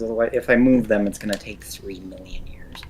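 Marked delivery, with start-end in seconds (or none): 1.1-2.8 unvoiced muttered aside; whispering. Real, not acted. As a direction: whisper it to yourself. Muttering to self.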